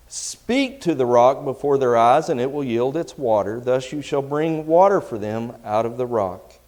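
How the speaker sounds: loud, projected Bible reading, pitch lifted; scripture reading. Acted. Preacher reading Numbers to his congregation, loud scripture reading. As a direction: read scripture out forcefully to the room.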